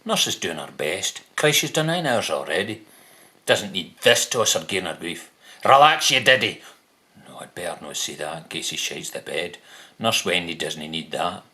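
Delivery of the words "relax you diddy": loud, higher imagined outburst; a burst of pent-up irritation; exasperated telling-off. Pretend. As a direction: tell him off loudly in your head, voice raised.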